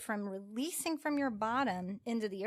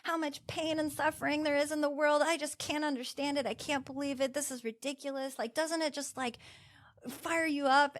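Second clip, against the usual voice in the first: client's worked-up outburst, pitched higher; imitating someone all fired up; a retold rant, raised and worked up; 6 semitones higher than usual. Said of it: "she was all fired up".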